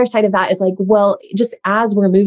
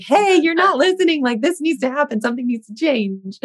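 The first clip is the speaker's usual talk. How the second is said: mock tantrum voice, dropping away; body voiced as child in tantrum; 7 semitones higher than usual. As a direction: voice the body as a child demanding attention, then trailing off. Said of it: "throwing a temper tantrum towards you, like".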